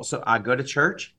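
usual speaking voice

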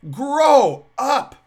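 loud, scolding grow up; stern grow up. Real, not acted. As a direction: bark the rebuke. Scolding the professors.

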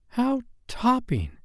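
high, drawn-out exclamation of awe; high-pitched childlike wonder; delighted boys. A narrator's reading. John and Michael marvel at flying.

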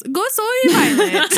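put-on high, slow little-kid voice through laughter; child's cute mistake. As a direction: say Go soy Violet like a proud small child. Child's mispronunciation voiced in story.

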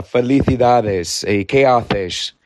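slow, drawn-out Castilian Spanish; Castilian accent. Shown as a demonstration. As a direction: show off a Castilian accent from Spain. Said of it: "a Castilian accent".

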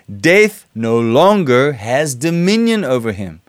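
scripture reading. Acted. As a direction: read the verses with weight, like a sermon. Preacher reads scripture aloud.

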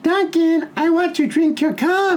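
very high, slow falsetto woman's voice; imitated girlfriend. Comic quoting neighbour's girlfriend through the wall, high falsetto impression. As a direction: put on a squeaky-high pleading girlfriend.

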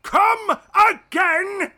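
high, loud, slow howl of outrage; outraged wail. Acted. Emperor wailing at news of the lost legions. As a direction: bellow your disbelief.

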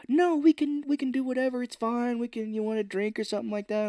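high, backpedalling plea; mock placating. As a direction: play the anxious let-down suitor. Acting out man urging her stay.

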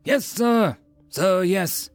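high-pitched military reply; recruits' response. A narrator's reading. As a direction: call it back like obedient recruits. Recruits answer drill officer.

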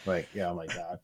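soft, low Northwoods Wisconsin uncle voice; Northwoods Wisconsin accent. Retold or imitated. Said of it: "sounds just like all of my uncles".

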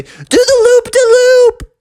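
high, loud, stretched-out squeal; excited call. Acted. Froggy begging Eddie for a stunt, slow high loud squeal. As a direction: draw the command out in a high frog shout.

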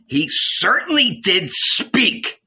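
slow, high-pitched, emphatic comeback; emphatic retort. A narrator's reading. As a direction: throw back a drawn-out, indignant reply. Simon retorts to anxious Matthew.

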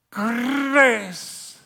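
loud Scottish brogue, long rolled R; Scottish or Irish accent. Shown as a demonstration. Preacher relishing the word, Scottish or Irish accent. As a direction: trill the R hugely in a Celtic accent. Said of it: "you can't say it except for in Scottish or Irish".

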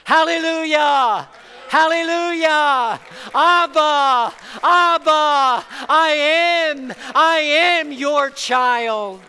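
ecstatic worship cry. Real, not acted. Pastor crying out to God in worship, ecstatic. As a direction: cry it out to heaven in rapture.

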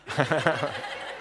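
quiet amused chuckle; real laughter at a joke; soft laugh. Real, not acted. Interpreter laughs at impression.

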